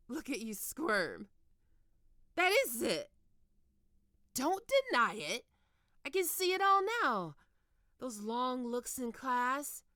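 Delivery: gloating. Acted. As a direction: crow over catching him out. Gloating at catching the student.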